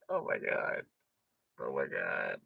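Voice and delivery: near-whispered, very soft; stunned under-the-breath muttering; hushed disbelief. Real, not acted. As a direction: let it drop to a low, breathed murmur. Exhausted disbelief after the rant.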